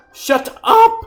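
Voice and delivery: very high, loud outburst; explosive high yell; furious shout. Acted. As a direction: scream them into silence, acted. Acted quarrel, silencing the accused.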